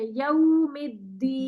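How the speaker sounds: یوم with fully rounded lips, drawn out slowly. Shown as a demonstration. Teacher shows lip rounding to student, slow and rounded. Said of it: "پورے ہونٹ گول کریں".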